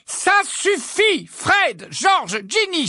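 very high, loud scolding; exasperated high-pitched yell; mother's cry. A narrator's reading. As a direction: shout each child's name to stop them. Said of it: "s'écria Missus Weasley".

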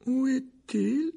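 very high, drawn-out bewildered question; quavering voice. A narrator's reading. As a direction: quaver it slowly, pitched far up. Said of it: "la voix chevrotante du membre de la commission". (voix chevrotante, aiguë et traînante)

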